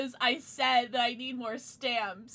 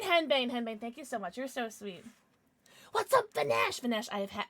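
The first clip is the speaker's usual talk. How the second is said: normal talking voice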